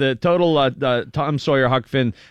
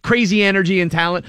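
ordinary delivery

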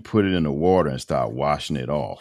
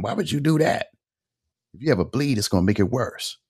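high-pitched, exasperated reproach; imitated scolding wife; 8 semitones higher than usual. Wife hears husband took ibuprofen, imitated high-pitched scolding.

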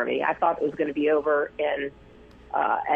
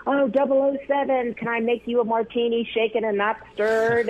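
higher, eager fan gushing; imitating a star-struck fan offering a martini; 7 semitones higher than usual. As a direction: gush at the movie star like an excited fan. Fan greets Sean Connery as Bond.

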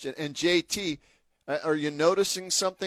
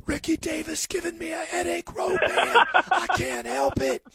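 higher, strained imitation of a coach with a shot voice; hoarse coach impression; 8 semitones higher than usual. Host imitates coach John Lucas complaining about a player in a strained voice. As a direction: mimic the coach straining to talk after yelling. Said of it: "And he'd be like".

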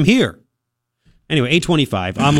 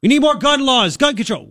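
mock slogan; 9 semitones higher than usual. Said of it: "There's nothing they can say".

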